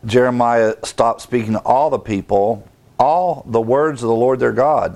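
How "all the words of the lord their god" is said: ordinary delivery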